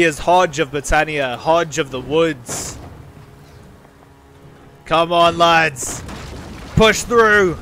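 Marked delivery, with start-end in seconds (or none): 4.8-7.6 high, loud yells to push; battle cry. Acted. Commander ordering his men to break the gates, shouting. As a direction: roar them through the breach.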